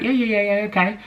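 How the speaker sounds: high, rapid yeahs to escape; hurried brush-off. Retold or imitated. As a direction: rattle off the yeahs just to get away. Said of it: "And I was just like".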